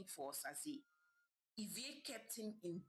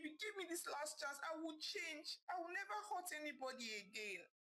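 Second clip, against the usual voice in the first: high, crying plea for forgiveness; tearful pleading; 8 semitones higher than usual. Acted. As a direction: beg her through tears, voice breaking high. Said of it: "pleading with Ivie".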